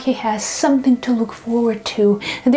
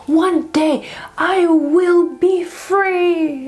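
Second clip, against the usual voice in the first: high, elated cries of joy, mock screaming; a freed beggar shouting his joy aloud, high and elated; joyful screaming; 8 semitones higher than usual. Beggar runs around telling everyone, high joyful mock shouts. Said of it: "runs around screaming".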